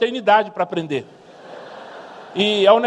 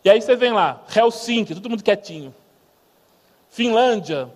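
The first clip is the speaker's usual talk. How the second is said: ordinary delivery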